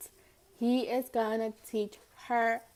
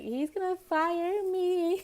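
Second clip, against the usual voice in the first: high, slow mock dread; mock panic; 9 semitones higher, slower than usual. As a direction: whine it out in high mock fear. Panicking about the boss.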